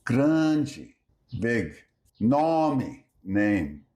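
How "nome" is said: Nome said nomi; final e as i. Shown as a demonstration. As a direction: end Nome on a short i. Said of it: "unstressed E becomes something like I".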